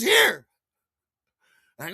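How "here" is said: high, loud, nervous here; hurried worker. Retold or imitated. Nervous worker hands over the bag fast, high squeaky voice. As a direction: shove it at him, squeaky.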